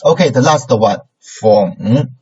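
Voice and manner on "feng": falling-rising FENG; third tone. Shown as a demonstration. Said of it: "the third tone".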